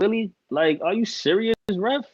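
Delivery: high, incredulous appeal to the ref; pleading with the referee. Real, not acted. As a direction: beg the ref in disbelief. Complaining at referee.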